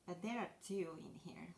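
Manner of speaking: normal talking voice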